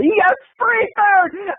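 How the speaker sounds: high, slow squeal of delight; drawn-out joyful yelp; gleeful cry. Retold or imitated. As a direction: squeal it like a kid seeing candy. Excited at free snack food.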